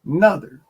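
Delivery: "Another" in a broad Southern "nother"; Southern word another. Shown as a demonstration. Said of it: "That's a Southern word for you".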